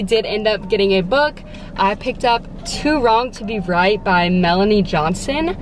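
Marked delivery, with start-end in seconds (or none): none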